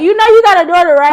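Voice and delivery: high, loud mock call-out to the daddy. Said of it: "you be like".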